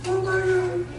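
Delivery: high, amazed exclaiming; overwhelmed with wonder. Retold or imitated. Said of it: "she said".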